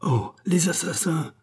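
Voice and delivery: high, startled cry of horror; a sudden high outcry at the killing; horrified cry. A narrator's reading. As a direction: gasp it out in shock. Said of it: "s'écria le docteur".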